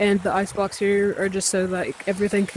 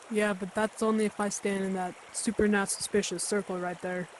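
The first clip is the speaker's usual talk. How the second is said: much softer deadpan bystander; skeptical bystander; 11 dB quieter than usual. Acted. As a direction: quietly point out the obvious.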